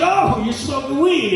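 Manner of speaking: catching his brother with a joint, thrilled; excited. Retold or imitated. To his little brother, an excited high squeal. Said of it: "so I kinda got excited".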